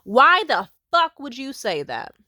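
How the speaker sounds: loud, high, slow outburst; exasperated. Real, not acted. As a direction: hammer it out in disbelief. Exasperated at the mother.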